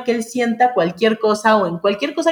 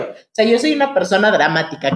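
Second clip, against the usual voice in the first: usual speaking voice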